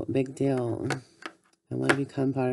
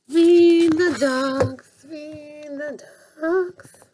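much higher, loud and slow sing-song call; squeaky call; 11 semitones higher, 7 dB louder, much slower than usual. Pretend. Toy character calls to feed the ducks, high and slow. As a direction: call it out high and drawn-out like a tiny creature. Pretend figure calling out.

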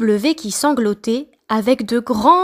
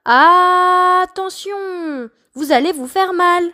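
stammering A; 7 semitones higher than usual. Acted. Letter A stammering a warning to the others. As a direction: stutter out a fearful warning. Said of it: "A bégaya".

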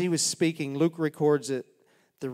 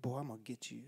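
quiet menace; 4 semitones lower, 13 dB quieter, much faster than usual. Retold or imitated. Imagined threatening look.

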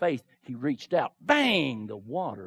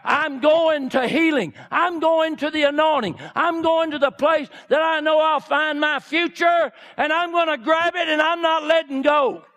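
full-force preaching; 8 semitones higher, 4 dB louder than usual. Acted. Woman declaring she will reach healing, full-force preaching. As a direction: declare it like nothing will stop you.

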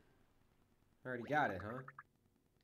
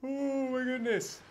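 surprised; 11 semitones higher, 6 dB louder, slower than usual. Real, not acted. Reacting in surprise to game.

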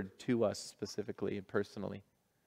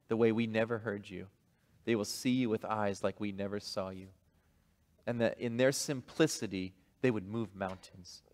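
soft, hushed pastoral prayer; 4 semitones lower, 7 dB quieter than usual. Real, not acted. Pastor praying softly over the children in the room.

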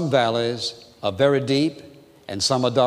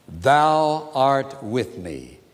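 slow scripture quote; much slower than usual. Acted. Quoting Psalm slowly.

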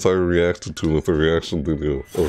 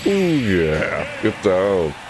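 ordinary talk, nothing marked

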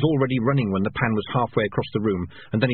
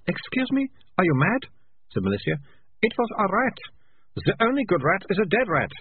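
higher, incredulous scoff; scornful girl; 9 semitones higher than usual. A narrator's reading. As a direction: treat him as mad. Malicia scoffs at Keith.

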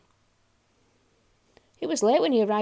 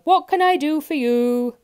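loud, raised-pitch bellow; booming shopkeeper's shout; bellowing grocer; 6 semitones higher, 10 dB louder than usual. A narrator's reading. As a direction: holler the question at the customer. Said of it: "bellowed the grocer".

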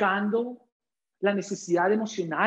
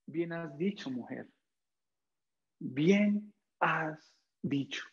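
soft, slow and gentle; tender Jesus; 4 semitones lower, 6 dB quieter, slower than usual. Acted. Jesus gently praising the Samaritan woman, soft and tender. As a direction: speak the praise softly, as a caring teacher.

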